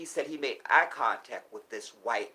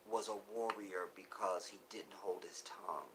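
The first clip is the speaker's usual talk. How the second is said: hushed; 2 semitones lower, 10 dB quieter than usual. Real, not acted. Emotional tribute, voice breaking.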